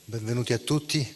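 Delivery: normal talking voice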